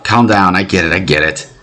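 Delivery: ordinary delivery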